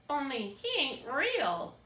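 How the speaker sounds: put-on high, slow old country woman voice. A narrator's reading. Old woman to the toy rabbit, high and slow. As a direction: drawl it out like a skeptical old woman.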